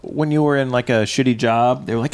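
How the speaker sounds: normal talking voice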